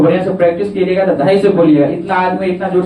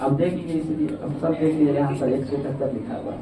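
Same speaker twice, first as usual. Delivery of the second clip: much softer aside to the class; 4 semitones lower, 11 dB quieter than usual. Real, not acted. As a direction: drop the voice to point something out. (धीमे से कहना)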